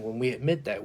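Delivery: ordinary talk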